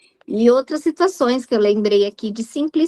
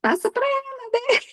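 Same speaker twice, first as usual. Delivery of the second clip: voicing Jesus; 12 semitones higher than usual. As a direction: lightly voice Jesus, pitched high. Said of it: "Aí Jesus". (voz de Jesus bem mais aguda)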